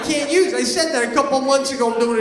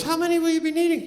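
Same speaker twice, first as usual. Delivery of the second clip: high, quick clerk's question; front desk guy; 8 semitones higher, faster than usual. Retold or imitated. Front desk guy quizzing a guest about towels, lightly imitated clerk voice. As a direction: lightly mimic a hotel clerk, pitched up and brisk. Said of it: "And the guy goes".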